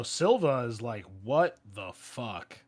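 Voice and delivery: ordinary delivery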